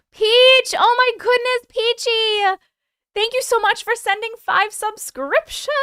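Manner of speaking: high, loud squeal of a name; excited greeting. Real, not acted. Streamer thanks Peach for gifted subs, delighted squeal. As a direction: gush over a generous viewer.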